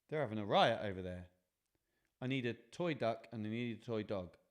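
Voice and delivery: ordinary delivery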